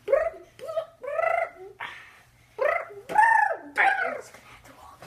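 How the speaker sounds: very high, loud, slow squeal. Acted. Telling the foreigner to walk, squealed high and loud.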